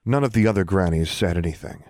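ordinary delivery, nothing marked

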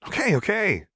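put-on grinning kid. A narrator's reading. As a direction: laugh it off as a cheeky kid. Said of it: "he grinned".

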